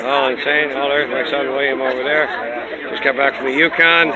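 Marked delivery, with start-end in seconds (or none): none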